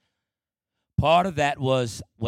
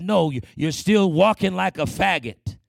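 higher, louder contemptuous retort; father's scorn; 5 semitones higher, 6 dB louder than usual. Retold or imitated. Father refusing the boy, louder contemptuous jeer. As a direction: spit it back like the scornful father. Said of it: "He said".

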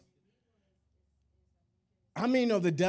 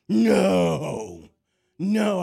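loud, drawn-out retold no; big preacherly no, much louder; emphatic refusal; 3 semitones higher, 9 dB louder than usual. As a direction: boom out the refusal as if in the argument.

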